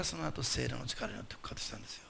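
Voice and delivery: soft reverent whisper of the resurrection; hushed whisper. Real, not acted. Solemn climax of sermon point.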